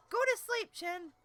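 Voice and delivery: high, loud aside to Chin; calling out an order. Real, not acted. Breaks off to order Chin to sleep.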